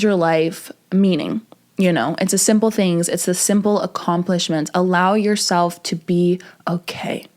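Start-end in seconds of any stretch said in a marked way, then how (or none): 4.7-7.3 slow, low near-whisper; gentle reassurance. Real, not acted. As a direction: let it sink into a slow whisper. Closing reassurance to viewers.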